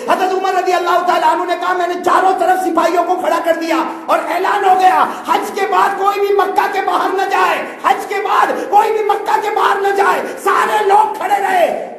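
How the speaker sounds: high, loud impassioned sermon voice, half laughing; full-force preaching. Acted. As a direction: cry the Prophet's words out to the crowd. Preaching at full force, Umar's order.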